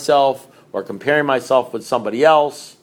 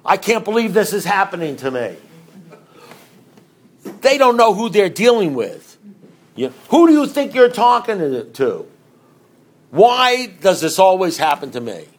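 higher, whiny poor-me voice; self-pitying whine; 6 semitones higher, 4 dB louder than usual. Retold or imitated. Recovering drinker mocking his own self-pity, whiny and higher. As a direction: whine like the world is against you. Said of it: "It sounded like this".

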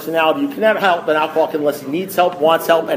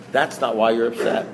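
calm sponsor; 6 semitones lower, 4 dB quieter than usual. Retold or imitated. Sponsor's calm verdict, acted out.

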